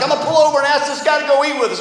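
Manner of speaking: eager dad. Retold or imitated. Dad to family in the car, eager and quick. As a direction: rattle it off high as an enthusiastic dad. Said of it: "my dad's like".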